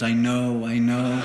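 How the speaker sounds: loud, monotone machine reply; talking tape recorder. Acted. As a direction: drone it back loudly, like a bored recorder. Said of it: "as I talk into it, it goes".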